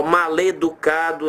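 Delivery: "maleducado" slowly, L glued to the E; run together as ma-LE. Shown as a demonstration. Teacher showing what to avoid, "maleducado" slowly joined as ma-leducado. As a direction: link "maleducado" into ma-le-ducado, drawn out. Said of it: "não quero formar maleducado".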